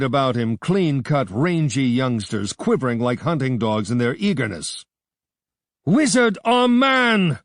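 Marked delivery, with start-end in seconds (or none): 5.9-7.4 slow, high, loud roar; young warrior defying the mob. A narrator's reading. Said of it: "roared Bardilis".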